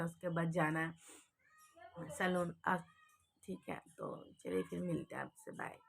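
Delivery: ordinary talk, nothing marked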